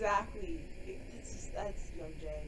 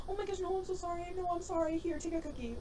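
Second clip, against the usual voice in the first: fawning apology; 7 semitones higher, faster than usual. Retold or imitated. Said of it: "I'd be like".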